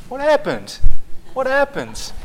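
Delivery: imitating a toddler asking again and again; toddler niece. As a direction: parrot the two-year-old's endless question. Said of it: "She just goes around saying it".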